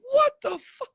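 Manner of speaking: very high, loud disbelieving cry; shocked shout. Retold or imitated. Reacting to the cheering crowd, high shocked shout. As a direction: yell out in outrage and shock. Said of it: "I'm like".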